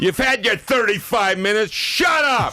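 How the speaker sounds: imitated high-pitched tirade; hammed-up screaming put-down; O'Reilly screaming. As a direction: yell it way up high, mocking an outraged man. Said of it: "he started screaming at me".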